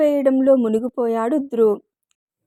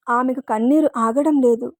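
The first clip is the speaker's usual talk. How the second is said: ordinary delivery, nothing marked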